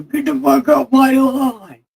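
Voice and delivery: put-on high, loud cranky old woman yelling; grumpy old lady. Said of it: "I'm pretending to be old".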